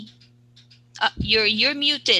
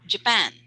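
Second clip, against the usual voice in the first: "Japan" as a quick ja-PAN; "Japan" stressed on its second syllable. Shown as a demonstration. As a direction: model "Japan" leaning on PAN. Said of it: "stress on the second syllable".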